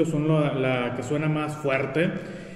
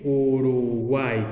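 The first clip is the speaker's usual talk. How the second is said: "Uruguay" drawn out slowly and lower, "u-ru-guay"; slow sounding-out; 4 semitones lower, much slower than usual. Shown as a demonstration. Teacher demonstrates the triphthong to students, slow sounded-out "Uruguay". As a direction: stretch "Uruguay", holding "guay" as one long syllable. Teacher drawing out the example word.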